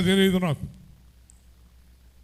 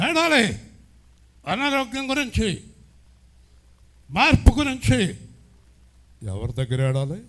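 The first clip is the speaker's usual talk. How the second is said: fervent preaching; 10 semitones higher than usual. Acted. Pastor scolding lazy believers, preaching at full force. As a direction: drive the rebuke home to the crowd.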